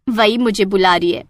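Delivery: ordinary delivery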